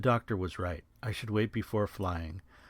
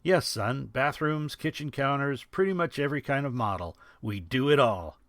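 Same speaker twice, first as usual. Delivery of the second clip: joking; 6 semitones higher, 4 dB louder than usual. A narrator's reading. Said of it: "Sarge joked".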